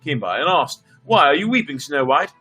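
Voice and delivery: measured storybook reading voice; story narration. A narrator's reading. Storyteller reading a Russian fairy tale to viewers, lightly voiced. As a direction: read the tale aloud to listeners.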